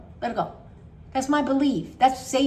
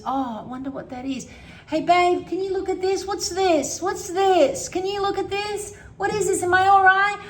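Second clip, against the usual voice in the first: higher, louder frantic questions; panicky worry; 8 semitones higher, 4 dB louder than usual. Retold or imitated. Acting out the anxious self asking babe about a lump, frantic. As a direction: ham up the panicky partner check. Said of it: "Continue is".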